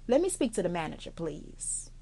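soft, sweet and calm, near a whisper on the thanks; sugary calm request, fading to a whisper. Shown as a demonstration. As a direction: kill them with quiet politeness. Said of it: "in a very calm, sweet voice".

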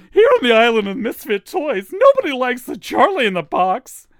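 high, loud cartoon toy voice; Charlie in the Box. Shown as a demonstration. Misfit toy announcing the Island of Misfit Toys, in a high cartoon voice. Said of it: "there was one voice that actually stood out".

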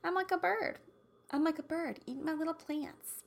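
high, cutesy bird-like voice; playful little bird. Pretend. Joking I eat like a bird, high playful voice. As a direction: lift it high and soft, like a small bird.

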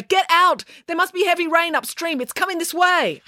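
high, urgent shouted warning; panicked alarm; alarmed cry. A narrator's reading. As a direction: warn them urgently, pitch held up. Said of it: "cried Loretta".

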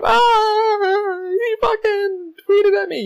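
high-pitched drawn-out fan squeal; freaking out. Retold or imitated. Fan retelling Hugh Jackman's tweet, high freaked-out squeal. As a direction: re-enact a slow, squealing freak-out. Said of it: "I fucking freaked out".

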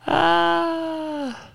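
long, high "ah" after laughing; high laughing sigh. Real, not acted. Host recovering from his own joke, high laughing sigh.

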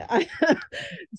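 a quick, light amused laugh; short laugh. Real, not acted. Guest laughing at her own answer, a quick light laugh. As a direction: laugh lightly at how many there are.

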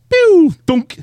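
high, loud mouth sound of a shot; gunshot and thunk noise. Retold or imitated. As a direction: imitate a bullet hitting. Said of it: "Then I hear".

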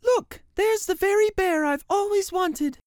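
put-on very high bright child's voice; little girl. Girl begging her mother for the bear, high child voice. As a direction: squeal it like an excited little girl.

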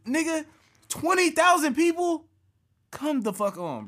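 very high, loud disbelief; incredulous outburst. Real, not acted. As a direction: shoot way up in pitch, loudly aghast at the number. Incredulous at crowd size.

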